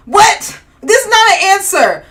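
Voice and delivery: high, loud indignant outburst; loud, incredulous objection; indignant disbelief. Real, not acted. Indignant reaction to a bad answer.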